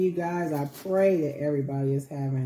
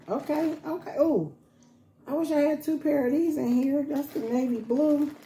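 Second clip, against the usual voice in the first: high, pleased socks find; light up over the socks; excited; 9 semitones higher than usual. Real, not acted.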